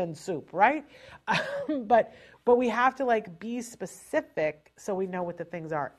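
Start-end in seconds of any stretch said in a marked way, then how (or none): 1.1-1.6 burst of laughter. Real, not acted. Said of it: "laughing at her own joke".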